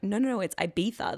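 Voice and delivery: "Ibiza" said Ibitha, with a th; insistent lisped pronunciation. Retold or imitated. Arguing back at boyfriend, lisped "Ibiza". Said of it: "that's how they say it".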